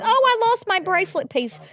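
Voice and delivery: high-pitched sudden outburst; oh-no moment, voice shooting high; startled exclamation. Real, not acted. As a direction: break off in real dismay, pitch leaping up. Suddenly notices a lost bracelet piece.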